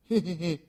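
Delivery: shrill forced laugh; Fortunato's frightened laugh; high nervous laugh. Acted. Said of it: "a sad voice".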